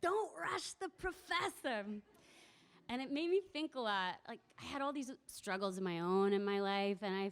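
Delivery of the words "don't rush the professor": slow, higher, grand mock dignity; old woman beaming as she plays the professor, slow and high; smiling lofty old lady. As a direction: say it with a big proud smile, slow. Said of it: "the big smile on her face as she said".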